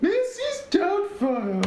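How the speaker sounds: very high, drawn-out singsong falsetto; Missus Doubtfire. Acted. Host repeating the joke, Missus Doubtfire falsetto. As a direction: stretch it out in a silly high voice. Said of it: "That's what it sounds like".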